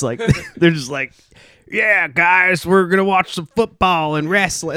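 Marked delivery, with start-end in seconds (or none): 1.7-4.8 higher, hammed-up American bro voice; mock your own country's American accent. American as the world imagines him, mock American accent. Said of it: "that's how I imagine every, the rest of the world thinks of us".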